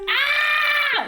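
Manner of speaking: loud, high-pitched scream; high sustained yell as an impression. Shown as a demonstration. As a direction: let out a full-voiced shriek. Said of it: "All I was gonna do was scream".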